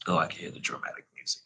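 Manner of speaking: quick breathy whisper, teasing; whispered aside. Real, not acted. Joking to listeners about the scene's drama, whispered. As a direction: hurry a playful whisper under your breath.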